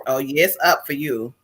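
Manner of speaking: low, softened knowing aside; retold low "mm-hmm" of approval. Host recalling seeing Lola's post, knowing approval. Retelling knowing reaction to Lola.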